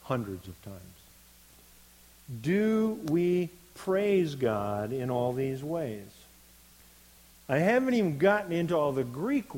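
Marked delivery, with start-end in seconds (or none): 2.4-6.1 loud, slow rhetorical question; emphatic challenge. Real, not acted. Preacher challenging the congregation about praise, emphatic and slow. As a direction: lean hard on DO and PRAISE, drawing them out.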